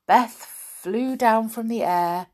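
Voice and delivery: ordinary delivery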